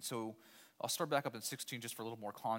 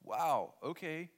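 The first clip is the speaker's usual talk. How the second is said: 1 surprised; 2 semitones higher, 4 dB louder, much slower than usual. Retold or imitated. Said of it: "Saul could look and go".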